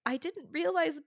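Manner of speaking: high, loud mock-innocent sarcasm; sarcastic quip. Mother teases doctor about breastfeeding pain, sarcastic. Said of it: "I was like".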